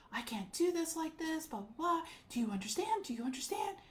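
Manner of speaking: high, loud mocking rant; retold yelling. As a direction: mimic her screaming down the phone. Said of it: "she's yelling at me, screaming at me".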